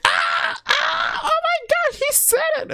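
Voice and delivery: shrill high squeal, very high; excited fan squeal. Retold or imitated. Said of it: "everyone was like".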